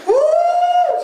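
high drawn-out "wuh" wail; mock wail. Busker bursts into tears at the song, imitated high wail.

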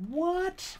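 high, sharp What? of surprise; startled what. Real, not acted. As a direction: yelp in sudden confusion. Surprised by game event.

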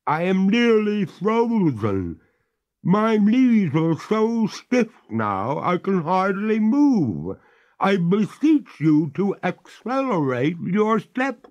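freezing ghost. Acted. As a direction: complain through the cold, slow and high.

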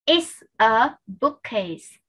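very slow, word by word; careful teacher's demonstration with gaps; slow model sentence. Shown as a demonstration. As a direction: space out each word for learners to copy.

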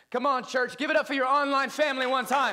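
high, loud call for applause; rallying the crowd. Real, not acted. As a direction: whip the congregation up to cheer. Rallying congregation to applaud online viewers.

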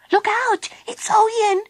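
very high little squeal of alarm; shocked scream. A narrator's reading. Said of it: "screamed Baby Bee in shock".

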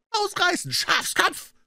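very high, strained retort; angry scream. A narrator's reading. Puh to frightened comrade, angry scream. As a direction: throw it back as an insult. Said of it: "schrie Puh".

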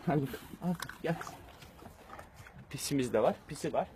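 high soft cooing at pets; talking to a dog. Real, not acted. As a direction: coo softly to the pets. Cooing at puppies and cat.

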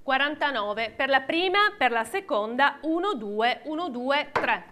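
rhythmic auction calling; auctioneer. Real, not acted. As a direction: call the bids like an auctioneer. Live auction, calling bids.

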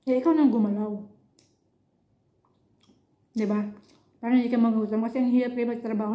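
chewing while talking; speaking around food; mouth full of food. Real, not acted. Seen 1: puts fish piece in mouth at 0.6, then chews while speaking.

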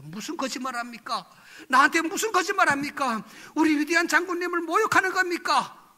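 building from normal voice to loud, high outrage; retold angry accusation. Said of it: "그러면서 내가 화를 냈습니다". (화를 내며 점점 크게 따지듯)

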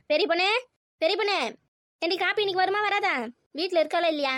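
loud, high impatient summons; impatient shout across the house; calling out. Acted. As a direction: holler for your daughter in the kitchen. Calling daughter for the coffee.

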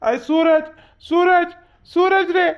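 loud, high-pitched hail; calling out. Acted. Friend calling out for Suraj, loud high calling. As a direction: shout the name to summon him.

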